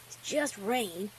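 soft, slow big-brother reassurance; reassuring brother. Acted. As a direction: soothe a scared little sister, unhurried.

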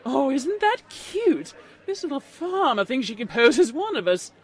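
mock her for the amused onlookers; snobbish mockery. A narrator's reading. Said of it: "snobbish unicorn only laughed and pointed".

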